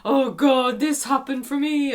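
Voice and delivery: high, loud dramatic parent parody; mock one-upping parent. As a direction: ham up a complaining parent. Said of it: "one up each other".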